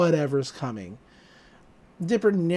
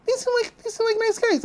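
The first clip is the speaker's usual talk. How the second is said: squeaky nervous Dipper; faster than usual. Retold or imitated.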